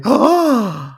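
loud, high, drawn-out gasp; shocked gasp. Retold or imitated. Host re-enacting his reaction to the episode, big shocked gasp. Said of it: "una cosa que dije".

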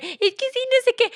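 high, loud, tearful retold sob-talk; crying. Retelling crying to family who asked what's wrong, tearful. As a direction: blurt it out as if starting to cry. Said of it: "y empiezo a llorar".

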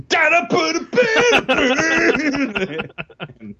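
very high cartoonish singsong; singsong it like a cartoon kid; exaggerated cartoon intonation. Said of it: "She's like".

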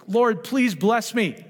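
slow, high, pleading mock prayer; a hammed-up begging plea to the Lord. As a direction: play a whiny believer begging God. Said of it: "if you go".

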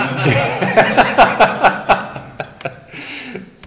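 bursting into a high breathy laugh; real laugh. Real, not acted. Preacher laughing at his own daylight savings joke, a real laugh.

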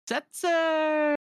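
very high, loud squeal; drawn-out high fangirl scream; screaming lady fans. Acted. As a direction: squeal the name like adoring fans. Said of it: "first the ladies".

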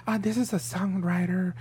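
high, sweet polite debutante voice. Retold or imitated. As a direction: put on a prim debutante voice. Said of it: "Why is your voice so high?".

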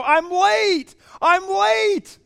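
high, loud joyful yell; excited yell. Retold or imitated. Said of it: "she yells out the tent window".

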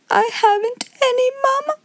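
put-on very high childish wail; lifted-up voice. A narrator's reading. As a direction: proclaim it as a piercing little-girl lament. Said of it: "lift up her voice".

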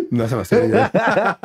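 laughs through the repeated words; cracking up as he echoes the line; laughing. Real, not acted. Repeats the joke while laughing. (riéndose a carcajadas mientras lo repite)

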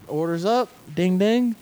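sing-song order call; calling out order ready. Retold or imitated. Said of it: "I'm like".

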